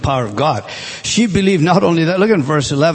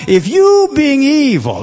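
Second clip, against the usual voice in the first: high, loud burst on the words; emphatic quote; 9 semitones higher, 6 dB louder, slower than usual. Acted. Jesus pressing the crowd, high and loud. Preacher voicing Jesus' sermon.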